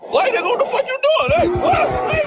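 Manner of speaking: high-pitched comic panic; panicked protest. Acted. Roommate suddenly set upon over breakfast, panicked. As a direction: voice shooting up in alarm. Breakfast argument turns into an attack.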